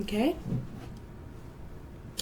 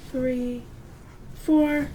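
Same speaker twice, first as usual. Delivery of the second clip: ordinary talk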